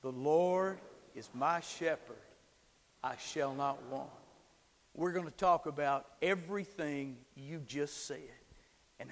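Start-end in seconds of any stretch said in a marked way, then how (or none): 0.0-4.1 slow, measured call-and-response; slow group recitation. Shown as a demonstration. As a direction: lead them through it slowly. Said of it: "Can you say that with me?".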